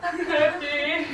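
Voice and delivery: high, slow, laughing birthday cheer; excited greeting. Real, not acted. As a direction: sing it out with a laugh. Surprise party greeting.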